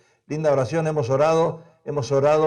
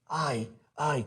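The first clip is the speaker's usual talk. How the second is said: mock lament; 3 semitones lower, 12 dB quieter, much slower than usual. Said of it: "como si le doliera algo".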